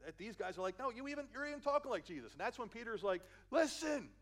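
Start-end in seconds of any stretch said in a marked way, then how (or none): 0.8-2.3 high-pitched, louder mock accusation; acted accusation. Bystanders calling out Peter's accent, high and insistent. 3.5-4.1 loud, high single-word outburst; acted outburst. Peter answering his accusers, loud and high.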